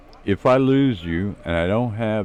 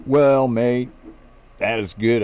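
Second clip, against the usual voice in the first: a light mock Australian accent, louder on well; teasing his friend by copying his Australian accent; mock Aussie accent; 4 semitones higher, 3 dB louder than usual. Narrator teases Magic, lightly mocking his Australian accent. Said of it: "playing with his accent and slang".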